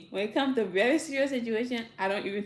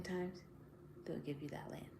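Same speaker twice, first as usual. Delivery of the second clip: whisper; 3 semitones lower, 15 dB quieter than usual. Real, not acted. Confiding the winning outcome.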